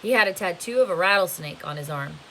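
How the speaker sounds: ordinary delivery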